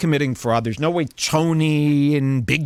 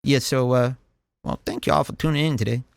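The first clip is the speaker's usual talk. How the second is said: shy [name]; 3 semitones lower than usual. Retold or imitated. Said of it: "this is him".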